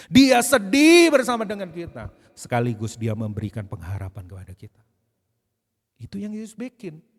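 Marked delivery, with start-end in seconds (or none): 0.1-2.1 higher, louder, slow preaching cry; impassioned cry. Acted. Preacher crying out to the congregation that Jesus grieves with them, loud and slow.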